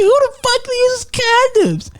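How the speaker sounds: mock-indignant squawk, high and drawn out; pissed off. Retelling a partner's reaction to condoms, pissed-off high voice. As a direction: imitate an outraged partner, high and slow. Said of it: "She just got pissed at me".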